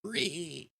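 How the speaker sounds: high squealing grunt, second try; zombie pigman squeal. Shown as a demonstration. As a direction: squeal like a pig zombie again. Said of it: "I always sound like a zombie pigman".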